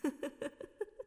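high soft giggle from hiding. Acted. Woman hides behind a pole, laughing.

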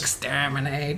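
mock robot voice; Doctor Who robot. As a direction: drone it out like a menacing machine. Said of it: "one of those robots from Doctor Who".

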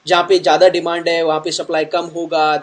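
rattled off quickly; fast. Real, not acted. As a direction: rush through the phrase. Fast Hindi aside.

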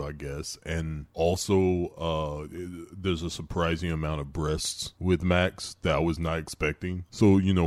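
low, soft lazy creep; Matt Dillon voice. Retold or imitated. Cohost voicing Matt Dillon to Lindsay, low lazy creep. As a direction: drop low and mumble it out.

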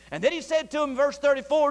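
ordinary delivery

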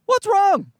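high, pleading mock panic; hammed-up frightened child; terrified child. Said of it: "She's like".

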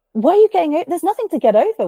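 high exasperated protest; mock exasperated disbelief on the phone. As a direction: pitch it up in disbelief. Exasperated reply.

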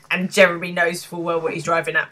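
usual speaking voice

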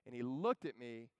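usual speaking voice

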